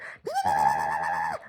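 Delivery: long, drawn-out imitated dog noise; imitated dog whine. Imitating the shepherd stuck in the car, long mock whine. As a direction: hold out an anxious dog's cry. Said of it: "it's like".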